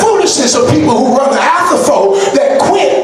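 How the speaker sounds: preaching at full force. Acted. Preacher rousing his congregation, preaching at full force.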